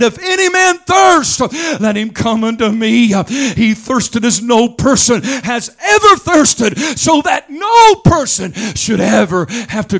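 high chanted preacher's whoop; rising sung preaching; preaching at full force. Acted. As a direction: sing it out like a revival preacher. Preaching crescendo.

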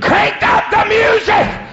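slow high shout with a laugh in it; cackle-shout like a gleeful devil; devil screaming. Acted. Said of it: "the devil screamed".

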